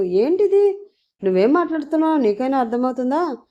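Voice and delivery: high, quick exasperated question; somewhat serious. A narrator's reading. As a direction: demand sense, rising sharply then rushing. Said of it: "కొంచెం సీరియస్గా అడిగాడు పృథ్వీ".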